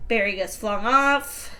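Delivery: usual speaking voice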